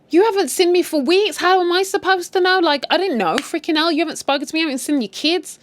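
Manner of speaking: high-pitched acted outburst of reproach; mock indignant. Woman arguing with absent partner, mock indignant. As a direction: play a fed-up woman having it out. Acted quarrel with a neglectful man.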